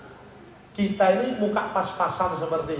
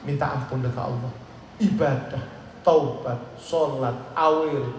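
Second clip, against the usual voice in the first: ordinary talk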